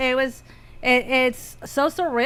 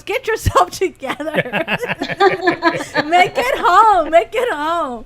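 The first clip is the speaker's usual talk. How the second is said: high, raised voice urging through laughter; laughing mock urging; 5 semitones higher, 5 dB louder than usual. Retelling friend urging her to drive on, loud and laughing. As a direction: playfully order her along, breaking into laughs.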